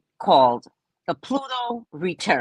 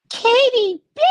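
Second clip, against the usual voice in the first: high, loud surprised hello; thrilled to spot an old friend online; excited high greeting; 7 dB louder than usual. Pretend. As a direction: squeal the name in delight.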